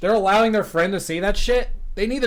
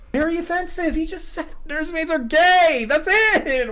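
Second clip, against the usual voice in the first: high, mocking, incredulous voice; mock disbelief; 11 semitones higher than usual. Co-host mocks the overreaction in a high incredulous voice.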